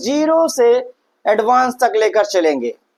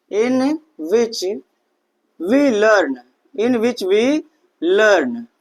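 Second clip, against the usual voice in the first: slow dictation; much slower than usual. Shown as a demonstration. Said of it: "dictating definition word by word".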